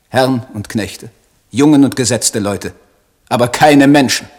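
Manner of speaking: building from firm to a loud, high cry; rising outrage. Acted. Poet railing at his countrymen, building to an outraged cry. Rising tirade against the Germans.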